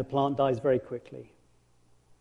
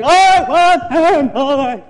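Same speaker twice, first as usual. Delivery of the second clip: very loud, very high exasperated wail; overheated groaning; 12 semitones higher, 13 dB louder, much slower than usual. Acted. Jonah sweltering in the sun, loud high exasperated wail. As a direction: moan it like he's melting in the heat. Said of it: "getting hotter and hotter, going".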